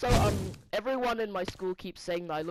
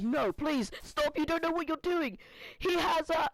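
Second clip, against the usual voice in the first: higher, pleading protest; panicked pleading; 8 semitones higher than usual. Retold or imitated. Retelling begging a friend not to tell, higher panic. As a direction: beg him to stop in a rush. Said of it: "And I'm like".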